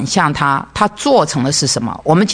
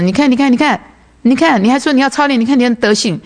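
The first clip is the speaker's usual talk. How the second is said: much higher, taunting jeer; mocking spouse; 9 semitones higher than usual. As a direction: needle her like a spouse pouncing on a slip.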